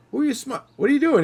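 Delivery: high, mock puzzled asking; puzzled question. Parents asking their son who he's smiling at, puzzled and high. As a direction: lightly act the baffled parent.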